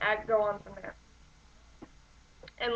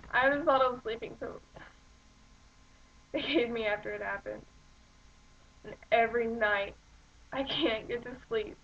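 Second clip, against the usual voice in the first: halting, subdued despair with long pauses; dramatic monologue. Acted. Bereaved character confessing sleepless nights, halting dramatic monologue. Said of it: "hear a good example of a monologue".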